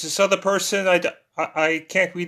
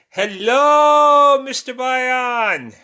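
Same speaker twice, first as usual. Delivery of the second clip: high, drawn-out hello, loud at first; big high-pitched hail, stretched out; exuberant greeting; 8 semitones higher, 6 dB louder, much slower than usual. Real, not acted. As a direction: call out a long, delighted welcome. Calling out greeting to joining viewer.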